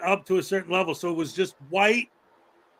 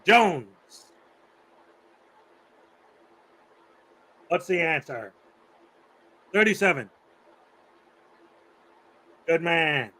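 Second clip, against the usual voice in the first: teacher impression; 2 semitones lower than usual. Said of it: "that's how he talked".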